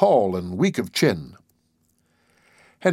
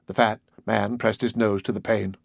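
plain reading voice

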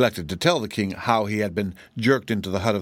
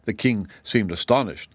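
ordinary delivery

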